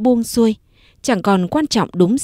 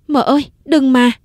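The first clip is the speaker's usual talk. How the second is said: pleading; 6 semitones higher, 4 dB louder than usual. Acted. Tí grabs her mistress's hand.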